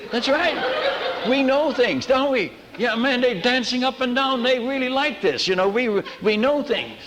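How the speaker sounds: high, laughing delight; laughing glee. Retold or imitated. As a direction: giggle through it like a shared secret. Gleeful villagers secret scene.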